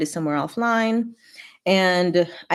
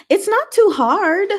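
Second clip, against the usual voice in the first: much higher, louder, slow and insistent; emphatic reassurance; 13 semitones higher, 6 dB louder, slower than usual. Real, not acted. Streamer assuring a viewer spreadsheets are easy, insistent. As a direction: coax them, it really is easy.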